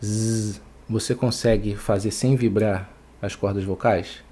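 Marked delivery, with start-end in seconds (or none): 0.0-0.6 an isolated buzzing Z; Z vozeado. Shown as a demonstration. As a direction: hold Z so the cords vibrate. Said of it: "é vozeado ou não é vozeado?". (Z vibrando, isolado)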